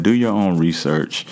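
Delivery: normal talking voice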